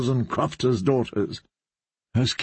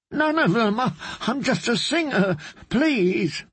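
high, frightened pleading bard; terrified bard pleading; 10 semitones higher than usual. Acted. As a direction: plead for his life, voice up high.

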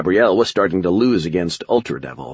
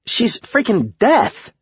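very high, drawn-out exclamation; incredulous protest; much slower than usual. A narrator's reading. Cindy objects to trusting Death, high incredulous protest. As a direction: protest it slowly, voice shooting right up.

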